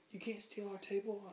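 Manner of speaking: high mock scolding; retold indignant bouncer. Bouncer forbids the theft, retold.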